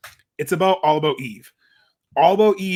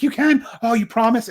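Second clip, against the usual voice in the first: high, louder giddy excitement; excited fan; 7 semitones higher, 5 dB louder than usual. Retold or imitated. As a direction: gush like a thrilled kid. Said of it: "And she's so excited".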